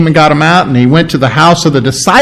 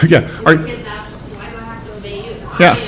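ordinary delivery

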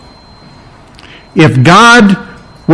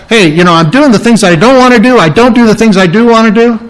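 quick, higher-pitched voicing of inner struggle; Peter; 5 semitones higher, faster than usual. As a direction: rattle it off like a man caught in his own muddle. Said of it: "what's Peter say".

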